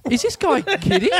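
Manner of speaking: high disbelieving cop voice; police officer disbelieving the driver, pitched high; incredulous cop. Retold or imitated. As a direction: squeak it out in disbelief.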